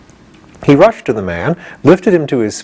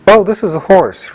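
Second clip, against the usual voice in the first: careless reply; 8 semitones higher than usual. A narrator's reading. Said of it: "replied the boy carelessly".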